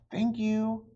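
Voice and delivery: high, loud call after the cat; calling out thanks. Acted. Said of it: "called Annie".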